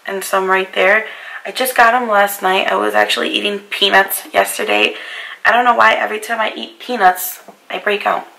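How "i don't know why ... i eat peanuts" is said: ordinary talk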